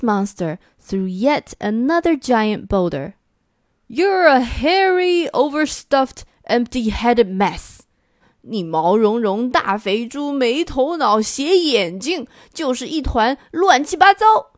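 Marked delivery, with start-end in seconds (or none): 3.9-7.8 first monster hurling a boulder and insults; high insult yell. Acted. 8.4-14.5 higher, building roar of insults. Acted. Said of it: "西边的怪物举着另一块巨石吼了起来".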